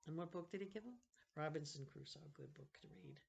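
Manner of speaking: ordinary delivery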